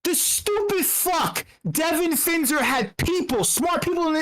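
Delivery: ordinary delivery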